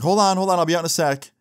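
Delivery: mock-flustered stalling; hurried call back. Teen stalling mom through the bathroom door, hurried call back.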